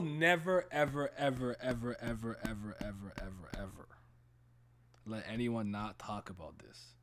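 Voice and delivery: ever repeated, fading from normal to a whisper. Shown as a demonstration. Host fading his vow down to a whisper. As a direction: let each ever fade away until it's a whisper. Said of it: "fading repetition of ever".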